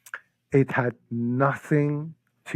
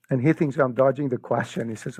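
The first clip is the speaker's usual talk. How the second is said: ordinary delivery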